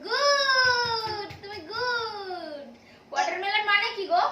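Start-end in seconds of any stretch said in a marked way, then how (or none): 0.1-2.7 drawn-out praise. Real, not acted. Praising the child's correct word.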